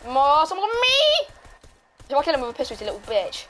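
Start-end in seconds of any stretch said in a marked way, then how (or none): 0.0-1.3 slow rising wail for help; high-pitched cry out. Real, not acted. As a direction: wail it upward as you get hit. Cries out when shot in game.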